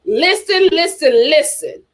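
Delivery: ordinary talk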